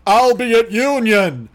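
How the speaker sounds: slow, booming mock announcement; drawn-out, hammed-up proclamation; mock basso profundo. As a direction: announce it like a pompous opera singer. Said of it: "basso profundo yelled at me".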